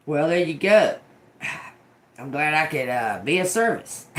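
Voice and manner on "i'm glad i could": normal talking voice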